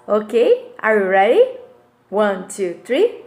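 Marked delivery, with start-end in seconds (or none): none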